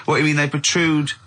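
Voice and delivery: ordinary delivery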